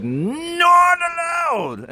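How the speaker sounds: hammed-up English accent, swooping up to a shout; mock English accent. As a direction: wail it in an exaggerated English accent. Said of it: "in an English accent".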